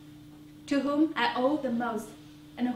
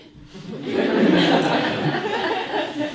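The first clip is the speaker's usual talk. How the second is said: warm burst of laughter, louder; 4 semitones higher, 7 dB louder than usual. Real, not acted. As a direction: laugh fondly and loudly. Laughs at mother's advice.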